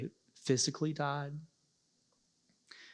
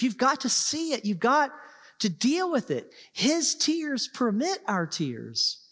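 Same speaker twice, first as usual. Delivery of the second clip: higher, louder fervent preaching, slowing on key lines; preaching at full force; 5 semitones higher, 4 dB louder than usual. Acted. Preacher urging grieving listeners to face the cross, impassioned preaching. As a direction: build the sermon to its peak and press each phrase.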